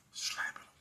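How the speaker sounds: "slapping him" said in a breathy unvoiced whisper, trailing off. Shown as a demonstration.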